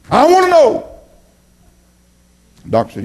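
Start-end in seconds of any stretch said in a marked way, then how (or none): 0.1-0.9 pitched way up, loud, mock-pleading churchgoer; whiny patient. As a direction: squeal it like a fussy patient begging the doc. Congregant pleading with doctor, mock.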